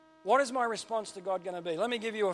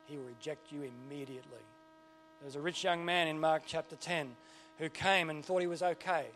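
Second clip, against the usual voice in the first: usual speaking voice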